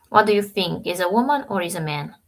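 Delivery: ordinary delivery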